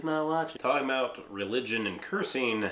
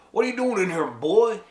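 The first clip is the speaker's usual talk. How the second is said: high, loud threatening call with an odd put-on voice; hostile yell; 8 semitones higher, 8 dB louder than usual. A stranger yelling at a young man in the bar, hostile yell. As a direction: bellow it at the stranger. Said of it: "someone yells".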